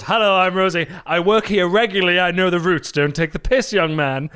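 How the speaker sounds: mocking Rosie. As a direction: imitate a bossy regular passenger. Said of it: "gets in the taxi and goes".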